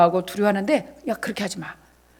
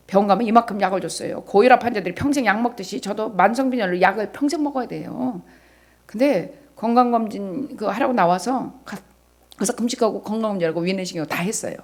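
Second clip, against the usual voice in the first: ordinary talk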